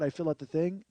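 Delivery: ordinary talk, nothing marked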